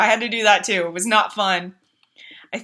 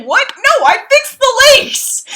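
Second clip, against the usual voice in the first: high, loud, slowed protest; outraged squeal; 7 dB louder than usual. Retold or imitated. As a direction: protest it like it's unfair, much higher. Toxicity alert kills her.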